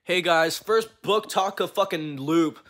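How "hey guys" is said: ordinary delivery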